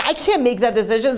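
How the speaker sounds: high, overwhelmed protest; acted desperate refusal. Husband tells doctor he cannot choose, desperate.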